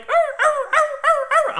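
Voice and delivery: very high yelps of a falling dog; imitated high dog yelps; dog yelping. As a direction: yelp like a hurt dog. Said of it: "the only thing you could hear was like".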